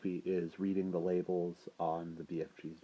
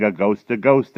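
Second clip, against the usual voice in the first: loud, raised cry of alarm; panicked yelling; 4 semitones higher, 16 dB louder than usual. A narrator's reading. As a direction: sound the alarm like a crowd in panic. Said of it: "with everybody yelling".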